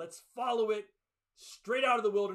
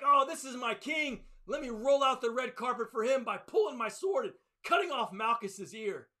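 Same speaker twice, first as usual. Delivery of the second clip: eager Peter; 5 semitones higher, 6 dB louder than usual. Retold or imitated. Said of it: "Peter's like".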